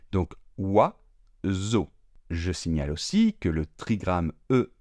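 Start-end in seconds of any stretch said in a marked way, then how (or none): 0.6-1.9 slow. Shown as a demonstration. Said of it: "très rapidement".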